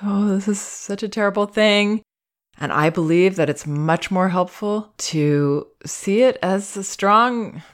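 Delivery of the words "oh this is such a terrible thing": higher, mock pitying coo; gently mocking pity; sympathetic voice. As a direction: imitate a syrupy sympathetic parent. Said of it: "Sympathetic tends to be".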